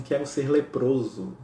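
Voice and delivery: slow, solemn retold request; understated tearful plea. Francis tearfully asking Jesus, solemn and slow. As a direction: say it slowly and earnestly, dry-eyed. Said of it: "com os olhos em lágrimas".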